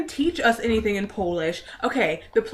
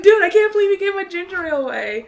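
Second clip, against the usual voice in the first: mock outrage; 9 semitones higher, 7 dB louder than usual. Retold complaint, high and loud.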